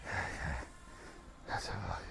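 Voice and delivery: soft breathless whisper; spent, barely voiced; exhausted whisper. Real, not acted. Exhausted after workout.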